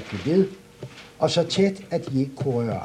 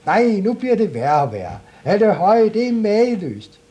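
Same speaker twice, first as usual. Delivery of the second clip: high and loud, slowing at the end; thrilled shouting; 10 semitones higher, 7 dB louder than usual. Acted. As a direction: marvel loudly at the treasure. Klods Hans thrilled by find.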